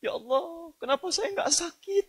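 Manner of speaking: soft, high-pitched mock-tearful plea; imitating weeping. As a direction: plead to God in a small, high imitated crying voice. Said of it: "Nangis dia".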